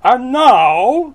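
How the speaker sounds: high, loud, theatrical narration; booming picture-book climax; grand storybook reading. Acted. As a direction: proclaim it to an audience. Reads the book text aloud.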